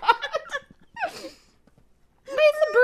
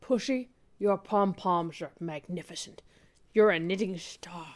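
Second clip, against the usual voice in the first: hushed low smooth-agent voice; suave spy; 7 dB quieter than usual. Acted. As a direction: play the cool spy, pitched well down. Plays a spy in a skit.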